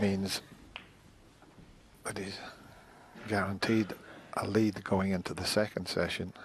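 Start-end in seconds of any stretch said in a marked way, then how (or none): 2.0-2.4 snooker commentary whisper. Real, not acted. Hushed snooker commentary aside.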